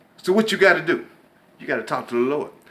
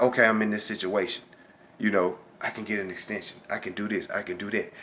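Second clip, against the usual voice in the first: calm inner voice; 4 semitones lower, 7 dB quieter than usual. Retold or imitated. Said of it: "relax and think about".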